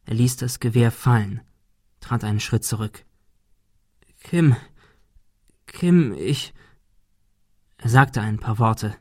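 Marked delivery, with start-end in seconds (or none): 4.2-6.5 faltering, halting plea; trembling lips. A narrator's reading. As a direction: stammer the name, struggling to speak. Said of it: "doch seine Lippen bebten".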